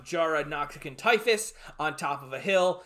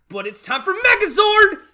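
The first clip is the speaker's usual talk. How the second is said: high, loud shout of excitement; hyped hero call; 13 dB louder than usual. Acted. Host heralding the giant robot's arrival, hyped shout. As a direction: belt it out like a battle cry. Announces the Megazord moment.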